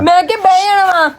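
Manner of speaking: high, loud tearful protest; crying. Real, not acted. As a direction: wail it out. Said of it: "ਰੋਂਦੀ ਕਿਉਂ ਪਈ ਆ?".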